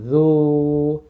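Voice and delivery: জু with rounded lips, held long and thin; rounded long vowel. Shown as a demonstration. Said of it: "টান হবে এবং ঠোঁট গোল হবে".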